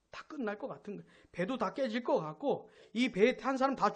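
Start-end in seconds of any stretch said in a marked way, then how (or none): 0.1-1.0 "다 끝날 것 같은 거" dropped very soft; hushed echo. Real, not acted. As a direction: let the repeat fall away quietly. Hushed echo of previous line. (작게 읊조리듯 되뇌기)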